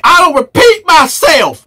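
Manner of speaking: a menacing warning just before the slap; Fleece Johnson voice. Acted. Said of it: "my Fleece voice".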